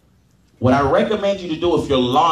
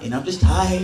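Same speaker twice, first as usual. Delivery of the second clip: mock excuse. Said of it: "the excuse is gonna come".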